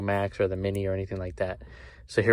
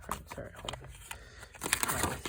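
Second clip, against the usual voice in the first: whispering; 3 semitones higher, 13 dB quieter, much faster than usual. Real, not acted. Whispered aside off camera.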